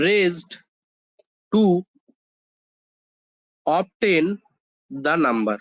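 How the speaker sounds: definition read out phrase by phrase with long pauses; slow dictation. Acted. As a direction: dictate slowly so students can write.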